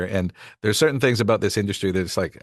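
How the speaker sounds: usual speaking voice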